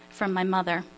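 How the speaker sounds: ordinary delivery, nothing marked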